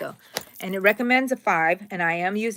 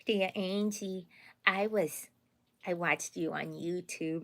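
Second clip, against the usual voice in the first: imitating the kiddos; 6 dB quieter, slower than usual. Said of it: "sometimes they go".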